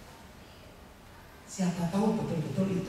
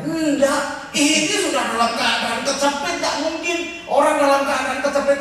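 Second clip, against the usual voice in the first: louder, pressed and urgent; urgent cry; 6 semitones higher, 7 dB louder than usual. Shown as a demonstration. Preacher showing how a cornered man cries out. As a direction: push it out like someone cornered. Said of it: "ngomongnya kayak saya orang Solo".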